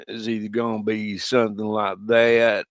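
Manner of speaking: exaggerated drawled American accent; a Brit's put-on American accent. Shown as a demonstration. Brit showing friends how Brits fake Americans, mock American accent. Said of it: "Brit to do an American accent".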